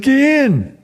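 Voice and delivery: high, loud sideline command; coach shouting an order. Retold or imitated. Coach sending the freshman into the game, loud shouted order. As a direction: bark him onto the field.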